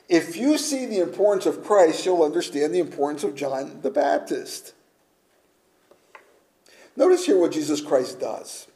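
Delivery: ordinary talk, nothing marked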